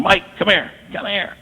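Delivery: retold high-pitched call, held back in volume; mock scream. Father storming in after his hiding son, mock scream. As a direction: imitate an angry yell without really shouting. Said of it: "he starts screaming".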